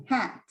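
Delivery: "hat" with lips spread, clear short a; stretched-lip hat. Shown as a demonstration. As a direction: lips wide, say "hat" for the class. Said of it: "Listen to me".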